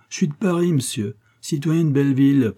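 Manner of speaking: lively, high-pitched self-introduction; exclaiming. A narrator's reading. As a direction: announce yourself brightly, pitch jumping up. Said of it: "s'écria Léon". (s'exclamer avec entrain)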